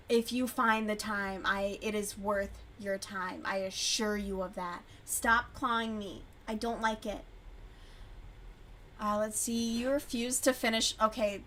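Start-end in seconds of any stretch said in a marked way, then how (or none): none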